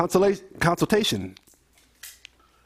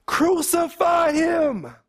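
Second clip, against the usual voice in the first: crowd shouting even more; 11 semitones higher, much slower than usual. Acted. The crowd shouting all the more at Pilate, a slow high scream. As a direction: push it past the first shout into a frenzy. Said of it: "But they shouted all the more".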